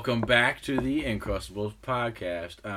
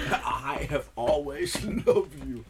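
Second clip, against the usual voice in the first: dramatic movie line; 4 semitones higher, 3 dB louder, much slower than usual. Acted. Quiet Place parody line.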